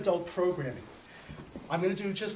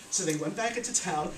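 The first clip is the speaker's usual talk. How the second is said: ordinary delivery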